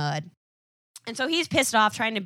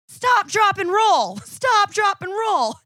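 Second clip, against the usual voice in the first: high, loud repeated safety chant; mock yelling; 10 semitones higher, 8 dB louder, slower than usual. Sue yelling the fire drill at burning Johnny, loud and high. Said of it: "she's legit yelling".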